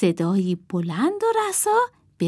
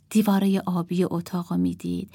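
ordinary delivery

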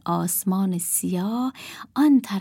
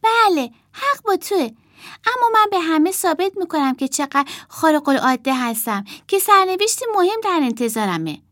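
put-on higher, earnest young boy; boy puppet voice; 9 semitones higher than usual. Puppet boy tells the girl he will prove himself, earnest. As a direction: voice the boy, proud and determined. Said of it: "جای پسرک صحبت کرد و گفت".

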